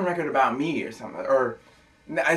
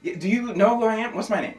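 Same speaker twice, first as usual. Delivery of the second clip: ordinary delivery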